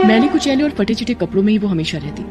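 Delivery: ordinary delivery, nothing marked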